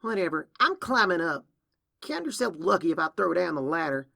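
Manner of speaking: high, loud, huffy brush-off; defiant dismissal. Acted. Younger sister announces she's climbing out, defiant dismissal. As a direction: brush them off loudly in a huff.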